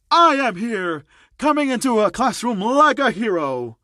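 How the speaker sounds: high, loud, grand announcement; booming hero entrance. Acted. Famous hero arriving in a classroom, booming and theatrical. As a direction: announce yourself like a showy superhero. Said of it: "a familiar voice echoed in the classroom".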